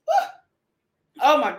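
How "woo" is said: excited whoop. Real, not acted. Whoop after tasting coffee.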